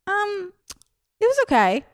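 unimpressed fan. Retold or imitated. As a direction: play the lukewarm fan, dragging it out.

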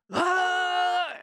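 high, sustained imitated yell; imitated resisting yell. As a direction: yell out one long protesting "Ah". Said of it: "goes into this yelling passive resistance mode".

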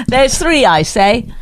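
mock a talker from a bygone era; old-time character. Co-host teases partner after laughing, old-time character. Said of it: "you are from this era".

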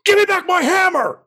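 very high, loud furious shout; furious yell. Acted. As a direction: scream it in a rage. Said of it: "he just yells".

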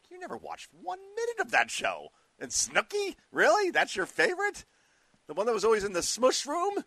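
high, loud, disbelieving outburst; incredulous disbelief. Retold or imitated. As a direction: sound like you can't believe what you just heard. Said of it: "And you're like".

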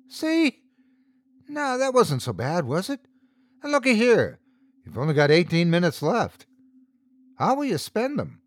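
high, mock-friendly tormentor; taunting character. A narrator's reading. Hank leans in close with stopwatch, high playful taunting.

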